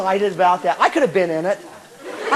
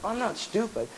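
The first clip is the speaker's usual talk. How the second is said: very soft, hushed protest; timid little comeback; meek denial; 2 semitones lower, 13 dB quieter than usual. Acted. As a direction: answer back small and quiet. Meek reply to nagging inner voice.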